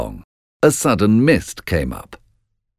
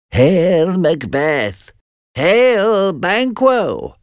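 high, wailing witch salute; witches hailing; 12 semitones higher, much slower than usual. A narrator's reading. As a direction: cry out the names like a witch. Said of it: "the witches said together".

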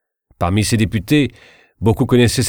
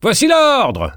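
shouted much higher and louder, jeering; insolent crowd shout; 4 dB louder, slower than usual. A narrator's reading. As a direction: hurl it triumphantly at Tilly. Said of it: "crièrent cent voix insolentes". (cri insolent de la foule, très aigu et fort)

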